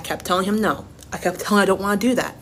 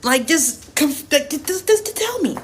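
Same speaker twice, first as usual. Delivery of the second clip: exasperated plea; 7 semitones higher, slower than usual. Real, not acted. Venting in disbelief.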